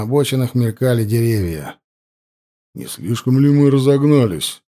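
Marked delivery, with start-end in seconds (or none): 2.7-4.6 anxious, a touch louder; worried question. A narrator's reading. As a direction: ask with mild worry. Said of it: "с заметным беспокойством спросил Кустовой".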